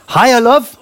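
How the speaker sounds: high, cheery mock local greeting; friendly local cashier. Cashier greeting a supermarket customer, imitated high, friendly call. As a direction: imitate a cashier's sing-out hello.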